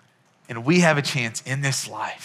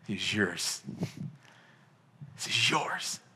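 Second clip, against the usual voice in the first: a hint of a judge declaring gently; judge's declaration; 3 semitones lower, 4 dB quieter than usual. Pastor retelling the judge granting adoption, softly solemn. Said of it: "basically they say".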